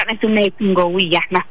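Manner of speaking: ordinary talk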